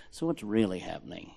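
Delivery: low, hushed prying question; sly gossip. Acted. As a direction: draw someone aside for the dirt. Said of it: "walk people like me into corners".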